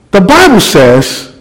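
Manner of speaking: slow, halting opening words; unsure of himself, easing in; hesitant start. Retold or imitated. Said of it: "I'm still stuttering".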